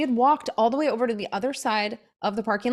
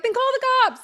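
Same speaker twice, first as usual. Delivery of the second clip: high, loud sassy comeback; 6 dB louder than usual. Retold or imitated. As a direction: toss it back at her, defiant. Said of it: "I'm a little sassy".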